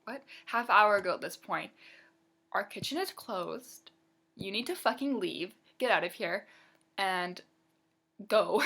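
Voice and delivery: waitress ranting about customers; furious rant. Real, not acted. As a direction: vent it like you're done with them. Ranting at customers asking dessert.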